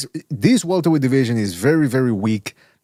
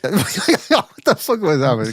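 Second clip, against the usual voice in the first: laughing disbelief; 3 semitones higher, much slower than usual. Real, not acted. Laughing at a mimicked voice.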